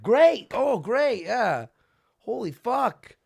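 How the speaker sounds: high, loud mock groan of sarcasm; reacting to the fan's question, loud sarcastic disbelief; sarcastic exasperation. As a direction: throw up your hands, sarcastic. Retold own exasperated reaction.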